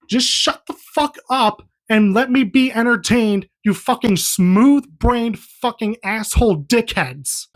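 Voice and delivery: exasperated insults, slowing down to land each one; worked-up rant. Real, not acted. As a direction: let fed-up exasperation push the pitch up. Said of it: "getting so worked up".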